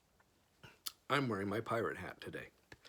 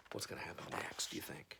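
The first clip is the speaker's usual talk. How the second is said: whisper; 4 semitones lower, 11 dB quieter than usual. Real, not acted. Asking viewers in whisper.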